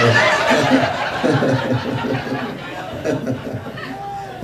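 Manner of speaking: long breathy laugh; big laugh. Real, not acted. The comic cracking himself up on stage, laughing. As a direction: laugh out hard. Laughing at his own joke.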